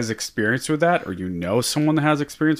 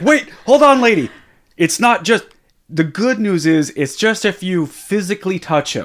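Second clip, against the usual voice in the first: higher, louder pleading doctor voice; 8 semitones higher, 5 dB louder than usual. Retold or imitated. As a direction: plead to calm her down. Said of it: "And it was like".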